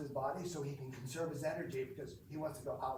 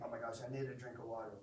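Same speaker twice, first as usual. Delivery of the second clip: fast, lower, softer mock complaint; imagined thirsty thought; 4 semitones lower, 6 dB quieter, faster than usual. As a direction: rush it like a parched student. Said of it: "And you're gonna think".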